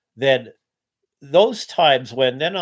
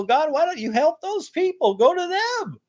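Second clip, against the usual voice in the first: very high, strained plea to God; pleading with God. Retold or imitated. Man begging God to help suffering people, very high strained plea. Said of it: "and I go".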